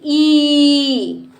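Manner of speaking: ई held long on a steady note; long vowel. Shown as a demonstration. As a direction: stretch ई right out. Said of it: "देर तक बोलते हैं". (देर तक खींची हुई ई)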